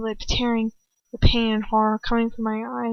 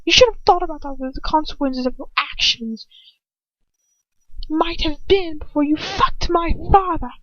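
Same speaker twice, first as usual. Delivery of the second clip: bitter anger; 5 semitones higher than usual. A narrator's reading. Draco accusing the captive, bitterly angry. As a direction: hurl it with bitter rage. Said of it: "just bitterly angry".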